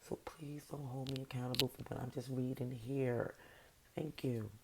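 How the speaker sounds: whispered disclaimer. Real, not acted. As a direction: say it under your breath.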